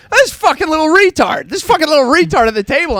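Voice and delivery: high, ranting yell; angry repeat that speeds up; furious dad. Retold or imitated. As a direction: imitate a dad railing at his son. Acting out dad's breakfast outburst.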